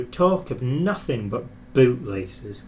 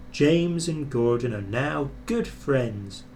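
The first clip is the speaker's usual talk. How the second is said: ordinary delivery, nothing marked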